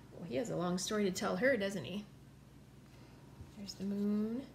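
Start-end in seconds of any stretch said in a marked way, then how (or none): none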